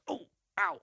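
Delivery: short, high cries of being hit; demonstrated ouch noises; pained grunts. Shown as a demonstration. Voice actor showing the noise of getting punched, pained yelps.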